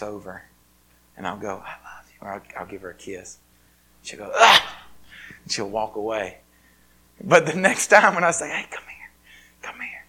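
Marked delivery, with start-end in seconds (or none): 1.6-2.2 softly whispered tenderness. Retold or imitated. Father whispering love to his little girl, tender whisper. 4.3-4.8 high, loud squeal of mock disgust; playful child squeal. Little girl squealing after her dad's kiss, high squeal. 8.5-10.0 soft, breathy coaxing whisper. Retold or imitated. Father coaxing his daughter close again, whispered. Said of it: "when I begin to whisper".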